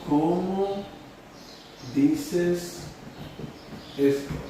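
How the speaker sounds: long pause after each word; halting pace of writing along; word-by-word dictation. Shown as a demonstration. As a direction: give the question one word at a time.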